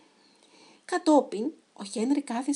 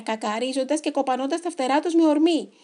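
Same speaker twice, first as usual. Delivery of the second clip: ordinary delivery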